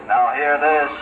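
over the loudspeaker; tinny ship intercom call; PA announcement. Acted. PA announcement repeated.

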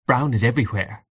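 ordinary delivery

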